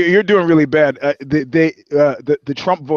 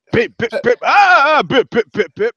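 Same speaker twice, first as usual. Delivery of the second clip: high, louder mock beeps with a laugh; silly beep noises; 8 semitones higher, 5 dB louder than usual. Beating a dog in a joke, high silly beeps. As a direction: chant beep beep beep playfully.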